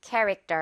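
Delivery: 캐릭터 with the end as a tense 떨; 캐릭터 front-stressed, weak 떨 to finish; repeat-after-me model. Shown as a demonstration. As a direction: model 캐릭터 for learners, no air on the T. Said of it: "One more time".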